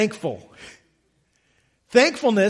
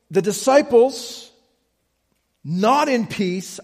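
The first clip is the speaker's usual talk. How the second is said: ordinary talk, nothing marked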